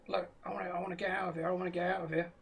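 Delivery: a low, hushed ask to get away; mild quiet plea. Acted. Frightened man to Brett on the platform, quietly pleading.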